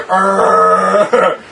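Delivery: donkey groan. Acted. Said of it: "Donkey just go".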